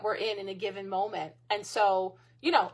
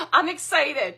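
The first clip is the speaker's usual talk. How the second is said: sarcastic; 5 semitones higher, 7 dB louder than usual. Acted. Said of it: "I'm being sarcastic".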